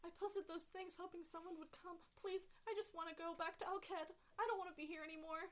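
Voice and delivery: pleading Kedian. Acted. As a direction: beg to be taken back. Sha'al pleading.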